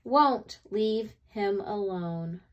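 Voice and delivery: usual speaking voice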